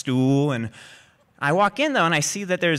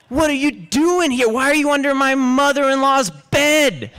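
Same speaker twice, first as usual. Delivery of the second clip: high, raised-voice demand; acted exasperated scolding; 11 semitones higher, 4 dB louder than usual. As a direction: scold him in loud disbelief.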